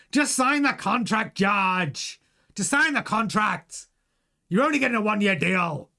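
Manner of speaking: hammed-up bad impression of a team boss; badly put-on voice, ordering a driver to sign; Toto Wolff. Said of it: "asking for my Toto Wolff impression".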